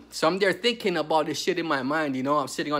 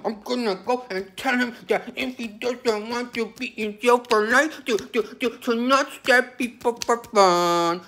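high, mocking imitation of the visitor; mocking her voice; 6 semitones higher, slower than usual. Mocking the visitor's plan to her face. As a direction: mimic the naive woman in a high whine. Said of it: "And that's the argument".

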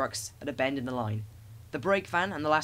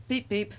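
high cheerful engine whistle; whistle beep; 7 semitones higher than usual. A narrator's reading. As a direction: pipe it like a little whistle. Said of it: "answered Thomas".

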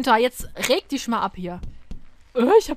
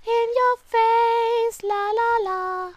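taunting singsong; 9 semitones higher, much slower than usual. Acted.

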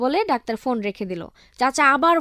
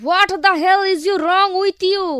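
high, loud, very slow furious demand; angry outburst; 5 semitones higher, 4 dB louder, much slower than usual. Acted. Angry officer scolds Boltu, high, loud and very slow. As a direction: drag out each word in fury. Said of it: "officer আরো রাগান্বিত হয়ে".

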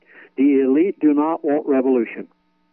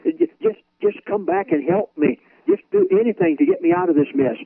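desperate pleading; 12 semitones higher than usual. Pretend. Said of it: "and you'll say".

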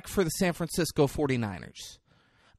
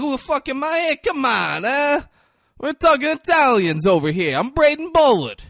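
loud, raised, hammed-up Italian accent; Braden; 9 semitones higher, 7 dB louder than usual. Host imagines co-host introducing himself, exaggerated Italian accent. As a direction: announce yourself in a brash mock Italian accent. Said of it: "doing an Italian accent".

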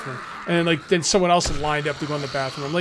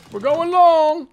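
playful shout; 11 semitones higher, 8 dB louder, much slower than usual. Real, not acted. Repeats remark as shout.

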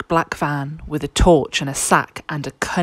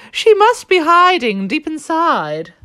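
goose voice; 12 semitones higher, 7 dB louder than usual. A narrator's reading. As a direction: coo it like a coy goose. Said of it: "the goose replied".

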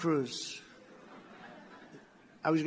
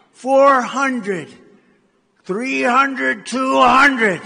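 higher, much louder altitude callout; computer countdown; 9 semitones higher, 18 dB louder, much slower than usual. Retold or imitated. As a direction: call it out like a blaring cockpit alarm. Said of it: "computer voice".

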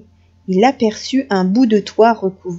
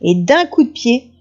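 ordinary delivery, nothing marked

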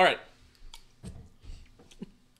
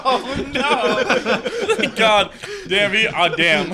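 higher, loud, helpless laughter through the words; laughing hard; 8 semitones higher, 8 dB louder, much slower than usual. Real, not acted. Friend nearly caught by a prank, laughing hard. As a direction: cry out relieved while cracking up. Cracking up after falling for joke.

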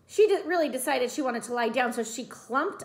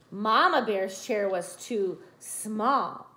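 plain reading voice

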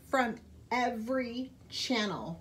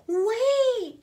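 much higher, louder shout to stop her; urgent cry; 13 semitones higher, 10 dB louder than usual. A narrator's reading. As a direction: call out before she leaves. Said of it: "she cried".

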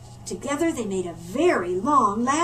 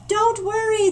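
much higher and louder call; 10 semitones higher, 7 dB louder than usual. Acted. Panda calling up to the climbing polar bear. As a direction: call up the ladder to reassure. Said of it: "the panda called".

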